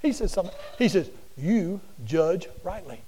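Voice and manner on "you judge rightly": measured, slow verdict; Jesus calmly telling Simon; slow, weighty. Acted. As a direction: give each word weight.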